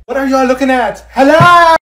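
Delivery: high, loud irritated call; loud and annoyed. Acted. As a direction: snap at them for staring.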